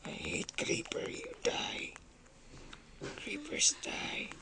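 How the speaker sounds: whispered grudge at the monsters. Real, not acted. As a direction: hiss your hatred under your breath. Whispering hatred at creepers.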